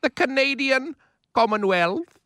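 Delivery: lightly voiced Queen Elizabeth; slow, lofty royal voice; Queen impression. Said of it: "our best impressions of the Queen".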